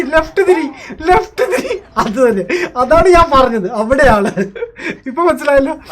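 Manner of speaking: loud, high, laughing directions; laughing excitedly. Real, not acted. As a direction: laugh as you steer your friend. Guiding teammate, laughing. (ചിരിച്ചുകൊണ്ട് ആവേശത്തോടെ വഴി പറയുന്നു)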